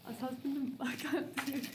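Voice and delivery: giggling, breaking up mid-sentence; words dissolving into nervous laughter; nervous laughing. Real, not acted. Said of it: "Take two".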